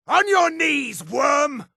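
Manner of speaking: high, shouted contempt; slow, drawn-out shouts of scorn at an enemy; battle insults. Acted.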